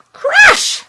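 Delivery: high shout; shouted crash. A narrator's reading. Reader yelling the monster noises, high shout. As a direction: yell it out like a crash. Monster sound words read aloud.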